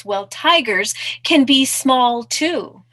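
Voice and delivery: drawn-out storybook protest; insistent mouse. Acted. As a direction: argue back as a plucky little mouse. Mouse argues back.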